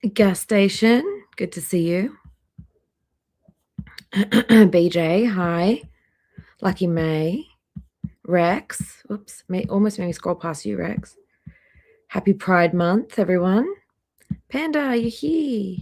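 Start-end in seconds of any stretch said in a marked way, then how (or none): none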